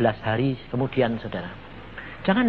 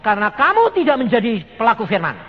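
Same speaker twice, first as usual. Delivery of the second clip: louder, higher and faster pronouncement; emphatic repeat; 8 semitones higher, 8 dB louder, faster than usual. Retold or imitated. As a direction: declare it boldly, quicker and louder. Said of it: "Saya cuma ngomong". (ulangi dengan lantang dan cepat)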